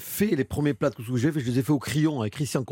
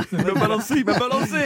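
laughing; 8 semitones higher, 5 dB louder than usual. Real, not acted. Guest laughing, teasing the host.

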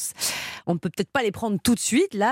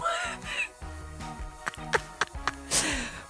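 laughing; 7 semitones higher, 9 dB quieter than usual. Real, not acted. Host laughs at his own joke.

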